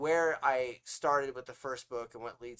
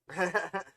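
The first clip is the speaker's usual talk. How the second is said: short laugh; 7 semitones higher, 6 dB louder than usual. Real, not acted. Laughs off own habit.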